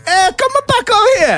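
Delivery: high-pitched fake Italian accent; mock Italian accent. Shown as a demonstration. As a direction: call out in a bad Italian accent.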